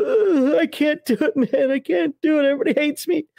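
high, whiny wail of self-pity; hammed-up helpless moaning; despairing breakdown. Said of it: "sitting in the fetal position go".

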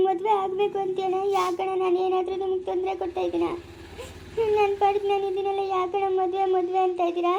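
high-pitched, crying plea to an elder brother; tearful protest. Acted. Sister begging brother not to push marriage, tearful.

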